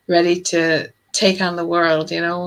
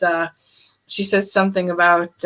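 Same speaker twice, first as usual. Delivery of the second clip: usual speaking voice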